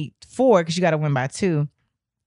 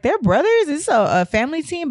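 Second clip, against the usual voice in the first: surprised; 8 semitones higher than usual. Retold or imitated. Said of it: "I'm like".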